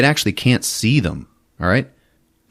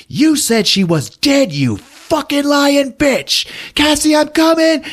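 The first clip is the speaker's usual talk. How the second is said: furious shout; slower than usual. Retold or imitated. Anya furious at Natalie's lie.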